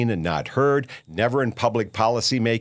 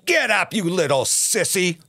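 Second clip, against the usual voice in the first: higher, sneering mock wife; mock scolding wife; 7 semitones higher than usual. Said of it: "and she says".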